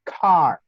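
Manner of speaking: car drawn out with a curled English r. Shown as a demonstration. Teacher giving an English example, drawn-out English r in car. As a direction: hold the untrilled r at the end of car. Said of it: "cómo decimos en inglés carro".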